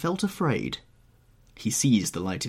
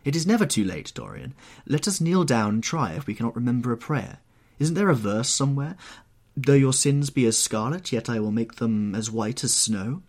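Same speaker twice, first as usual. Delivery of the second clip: higher, earnest entreaty; urgent, pleading painter; 4 semitones higher, 4 dB louder than usual. Acted. Painter begs young man to kneel, pleading. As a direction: urge him fervently toward prayer. Voices the painter urging prayer.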